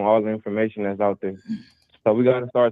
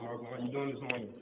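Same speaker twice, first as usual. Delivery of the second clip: faint, echoing phone line; echoing tunnel; 18 dB quieter than usual. Real, not acted. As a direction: sounds like calling from a tunnel. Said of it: "you sound like you're in a tunnel".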